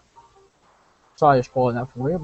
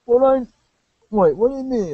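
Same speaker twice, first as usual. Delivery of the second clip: put-on sidekick voice; 9 semitones higher than usual. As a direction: play the laid-back sidekick, pitched way up. Sidekick reacts, voiced character.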